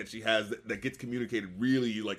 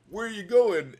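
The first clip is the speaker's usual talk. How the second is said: higher, louder mock question; lightly acted, raised-voice query; imitated calling after someone; 8 semitones higher, 5 dB louder than usual. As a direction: call it out like a puzzled tagalong.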